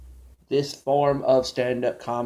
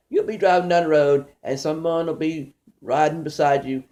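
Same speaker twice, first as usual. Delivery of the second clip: ordinary delivery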